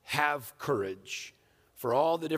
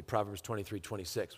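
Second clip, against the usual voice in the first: ordinary talk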